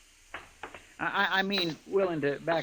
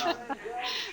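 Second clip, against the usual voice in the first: a high, embarrassed little giggle; nervous giggle; 7 semitones higher, 6 dB quieter than usual. Acted. Chester flustered in front of the lady, nervous giggle. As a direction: laugh it off, flustered.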